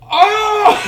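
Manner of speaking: very high, loud imitated yelp of shock; startled yelp. Actor realizing she's the speaker's girlfriend, shrieking. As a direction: burst out in high alarm. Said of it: "and he goes".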